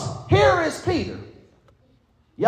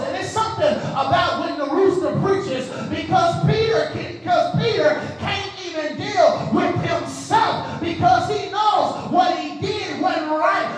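preaching at full force; 4 semitones higher than usual. Acted. Pastor pressing the Broadway congregation, in full preaching force. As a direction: drive every line home like a revival preacher.

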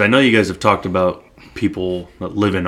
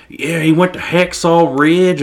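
slow, higher-pitched Southern drawl; Southern twang; 8 semitones higher, slower than usual. Retold or imitated. Telling Kayla about the war movie, Southern twang. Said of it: "started talking with some Southern twang".

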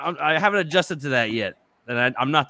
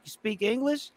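mock rude coworker; 10 semitones higher, 5 dB quieter than usual. Coworker mocking him, retold.

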